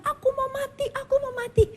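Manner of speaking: very high, sobbing wail; a broken man crying out he wants to die; crying despair. Retold or imitated. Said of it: "dia dengar suaminya nangis-nangis, teriak-teriak". (suami menangis meraung ingin mati)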